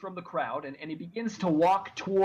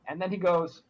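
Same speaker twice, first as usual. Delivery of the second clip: usual speaking voice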